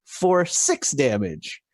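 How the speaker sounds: high, drawn-out crit call; excited announcement. Real, not acted. Player announces a critical hit to the table, excited. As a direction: relish the big roll, pitched high.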